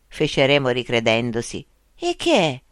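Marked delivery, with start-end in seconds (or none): 2.0-2.6 astonished. Acted. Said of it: "esclamò Cesare esterrefatto".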